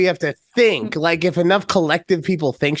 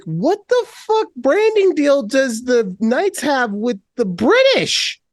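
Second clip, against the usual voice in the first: slow, high-pitched disbelief; exasperated bafflement; 6 semitones higher, slower than usual. Retold or imitated. Reenacting confusion over the Knights' branding, exasperated bafflement. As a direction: drag it out in mock outrage.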